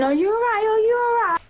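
put-on high, fussy girly voice asking; fussing girlfriend. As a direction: mimic the worried girlfriends fussing over the boys. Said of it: "the girls on the visit the boyfriends saying".

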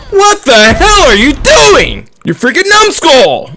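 high, loud, slow put-on rage voice; mock rage. [name] mock-raging at a teammate, high and loud. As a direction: scold a teammate in a cartoon fury.

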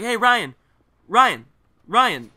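loud mock call for attention; imitated calling out. Classmate pesters Ryan to answer her pretend phone, calling out.

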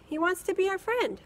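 put-on girl voice. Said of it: "Chrissy repeats robot's offer".

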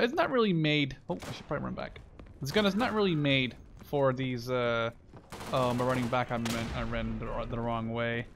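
ordinary talk

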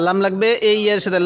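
ordinary talk

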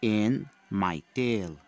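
put-on loud, slow recitation for young pupils; declaimed classroom poem, each phrase set apart. Shown as a demonstration. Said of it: "Say the poem aloud with accents".

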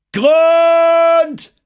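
very high, drawn-out sailor's call; high triumphant cry. A narrator's reading. Lookout at the bow calls the depth, triumphant high cry. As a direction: shout it ringing out, long and high. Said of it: "Die hohe Stimme schallte vom Bug".

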